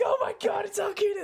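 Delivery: high, loud shriek of panic; hammed-up scared yell; mock panic. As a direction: shriek it in fake terror. Scared couple quoted.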